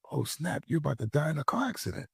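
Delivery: a sudden voice in his head, fast and soft; inner voice. Retold or imitated. An inner voice warns him mid-crash, quick and soft.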